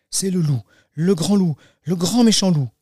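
hammed-up storybook dread, rising; alarmed realisation. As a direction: let the alarm grow with each phrase. Girl realises it is the wolf.

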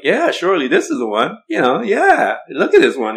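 high, loud eager prophet admiring the son. Acted. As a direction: voice him high and thrilled, pointing at the boy. Prophet admiring Jesse's eldest son.

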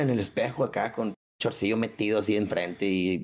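normal talking voice

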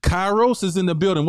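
lively host welcome; hype host roll call. Acted. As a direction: hype up the crowd like an MC calling names. Hosts roll call of guests.

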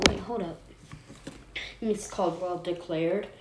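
ordinary delivery, nothing marked

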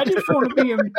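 high mock whine; hammed-up whiny sob; fake crying. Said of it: "I'm kidding, Bryce".

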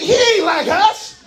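rising, shouted sermon cadence; preaching at full force. Acted. Preacher driving the point home to the church, shouting.

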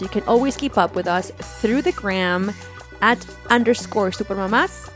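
ordinary delivery

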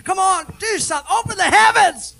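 high, loud demand to heaven; pleading shout. Retold or imitated. Worshipper demands God open the heavens, in a high, loud shout.

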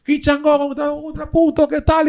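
high, loud impersonation of a stage guy; voz imitada del tipo. Retold or imitated. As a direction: mimic his patter high and loud. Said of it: "el tipo". (imitando al tipo, agudo y fuerte)

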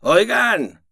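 high, loud call. Acted. The ray calling after the squad, loud. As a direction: raise the voice to stop them. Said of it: "alzó la voz el rayo".

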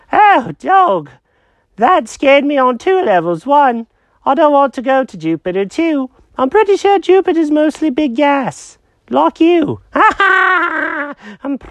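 high-pitched confused cat; squeaky cartoon cat, lost and puzzled. Jenkins voice.

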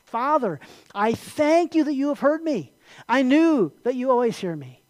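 high, projected address to God; declaimed prayer. Acted. Jesus prays aloud at the tomb of Lazarus, declaimed thanks. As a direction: call up to the Father in thanks.

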